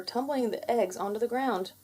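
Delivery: ordinary delivery, nothing marked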